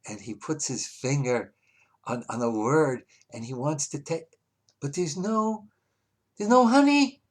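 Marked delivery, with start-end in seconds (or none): 4.8-7.2 high, upset storytelling voice; pitched-up retelling of a crushing letdown; child's dismay. Retold or imitated. Child finds no honey, upset.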